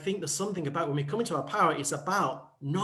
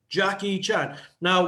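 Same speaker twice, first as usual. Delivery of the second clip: ordinary talk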